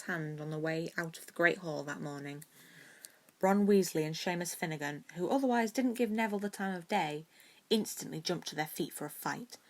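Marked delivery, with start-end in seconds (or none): none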